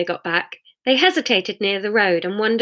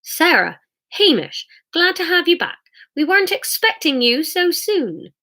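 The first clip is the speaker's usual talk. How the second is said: put-on high-pitched older neighbour voice; welcoming elderly lady; 9 semitones higher than usual. Missus Shue greets returning neighbours, high elderly-lady voice. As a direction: greet them in a raised character voice.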